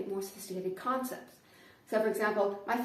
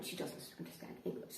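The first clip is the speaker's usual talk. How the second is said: ordinary talk, nothing marked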